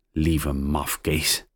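soft, slow fond words to her parrot; tender. A narrator's reading. Mia to her parrot, soft and slow. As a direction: murmur it fondly and softly. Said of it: "zegt Mia".

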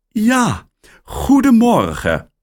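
surprised caller on the line; woman's voice. A narrator's reading. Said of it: "zegt de mevrouw".

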